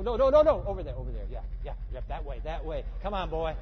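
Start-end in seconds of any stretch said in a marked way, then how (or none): 0.0-0.6 loud, sharp warning; urgent shouted no. Real, not acted. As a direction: stop him fast with a loud no. Guide shouting warnings on ATV.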